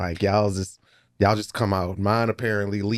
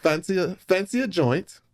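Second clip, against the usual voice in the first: higher-pitched mock English accent; English accent attempt; 8 semitones higher than usual. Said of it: "learn an English accent".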